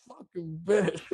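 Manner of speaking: high-pitched, hammed-up last words; mock dying curse from the floor; imitated dying man. Quoting the dying man's reaction.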